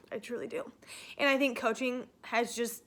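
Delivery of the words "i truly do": soft sincere whisper; real tearful whisper. Real, not acted. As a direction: breathe it out quietly, meaning it. Tearful affirmation.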